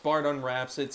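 normal talking voice

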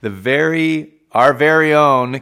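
high, slow, showy announcer opening; a hammed-up sports show welcome, drawn out; podcast host intro. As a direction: welcome the fans like a radio host.

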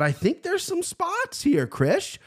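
very high, slowed falsetto on "spots"; playful hype. Pretend. Host hyping picks to co-host, playful high voice. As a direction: stretch "spots" out in a high squeal.

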